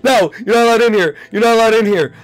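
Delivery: high, loud panicked shrieks; terrified screaming. Real, not acted. Gamer screaming at the animatronic not to come in, terrified. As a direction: yell to keep it out.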